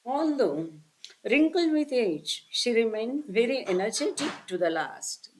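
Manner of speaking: drawn-out, careful read of the passage; slow, solemn recitation; very slow reading aloud. Acted. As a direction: stretch each phrase of the text for the listeners. Reads the English text slowly.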